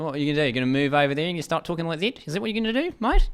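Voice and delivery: high-pitched, hammed-up New Zealand accent; bad New Zealand accent. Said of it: "That is a horrific New Zealand accent".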